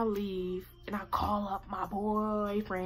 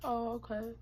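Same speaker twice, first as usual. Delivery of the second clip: ordinary talk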